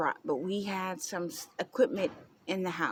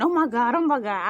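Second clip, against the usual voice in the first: mocking mom's panic; 5 semitones higher, 9 dB louder than usual. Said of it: "while you run around saying".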